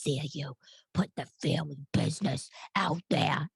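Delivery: whispered mock-scolding mother; hushed mock of mother's reproach; imitating scolding mother. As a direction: hiss it as a disapproving mother. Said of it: "she's gonna say".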